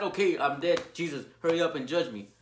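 loud mocking impatient sinner; hammed-up loud impatient demand; mocking careless sinner. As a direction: mimic a brash careless sinner loudly. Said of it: "Are you just gonna tell Jesus Christ that".

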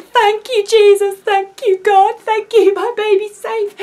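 high, loud, frantic relief; hammed-up hysterical gratitude; overwrought thanking. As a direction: re-enact desperate prayers of thanks, pitched high and loud. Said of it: "was a complete mess".

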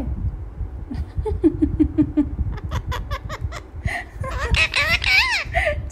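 high loud laughing fit; shrieking real laughter. Real, not acted. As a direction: laugh helplessly at the bird. Laughing at parrot.

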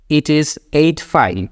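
very slow English time reading; slow example phrase. Shown as a demonstration. Teacher voices a literal translation for learners, slowly. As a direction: lay out each word separately. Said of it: "আমরা ইংলিশে তাহলে যদি বলি".

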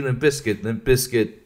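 usual speaking voice

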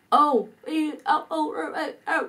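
high wordless mute-man cries; mute man's cries. Acted. Mute man calling out to two women, high wordless cries. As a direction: cry out in short high vowels, unable to speak. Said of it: "cái ông kia kêu".

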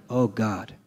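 ordinary talk, nothing marked

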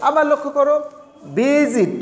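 normal talking voice

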